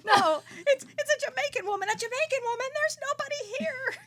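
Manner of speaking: put-on high, loud, half-laughing boyfriend voice; panicked boyfriend. Boyfriend staring at the empty room, high and frantic.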